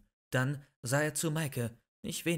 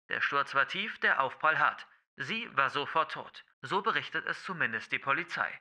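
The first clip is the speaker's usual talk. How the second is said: loud, formal newsreader delivery; news read; 3 semitones higher, 5 dB louder than usual. Acted. Newsreader announcing a tragic accident, formal news read. News report inside the story.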